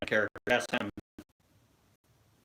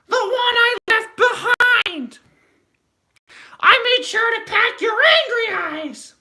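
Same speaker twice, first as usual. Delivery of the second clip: very high, slow, squeaky toy-lady voice; shrill cartoon Mrs. Potato Head; 8 dB louder, slower than usual. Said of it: "such a perfect, uh, Missus Potato Head".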